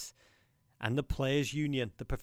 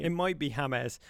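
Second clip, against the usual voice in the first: ordinary delivery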